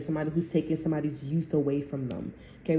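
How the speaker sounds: ordinary talk, nothing marked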